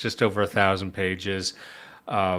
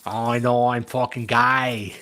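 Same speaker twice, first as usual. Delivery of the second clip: hammed-up Australian accent; comic mock Aussie accent for an imagined Brazilian; 3 semitones higher, 4 dB louder than usual. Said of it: "a Brazilian with an Australian accent".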